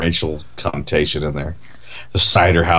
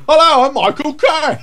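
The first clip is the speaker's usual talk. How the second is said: much higher, louder Cockney introduction; Michael Caine impression; 4 dB louder than usual. Caine does himself on a talk show, high and loud. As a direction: announce yourself as Caine, hammed up. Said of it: "And Michael Caine goes".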